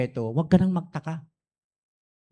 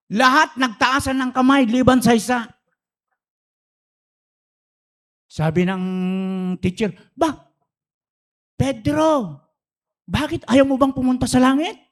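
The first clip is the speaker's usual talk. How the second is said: lively storytelling; 9 semitones higher, 4 dB louder than usual. Retold or imitated. Excited joke telling, teacher asks.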